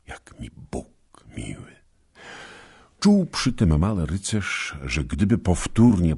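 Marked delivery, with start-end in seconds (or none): 0.1-1.8 whispered blessing. Acted. Tender blessing.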